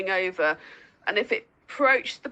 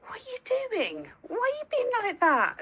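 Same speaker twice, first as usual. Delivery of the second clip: much higher, fond exasperated questions to the dogs; mock exasperation; faster than usual. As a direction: ask the dogs what they're up to, pitched way up. Owner quoting herself to dogs.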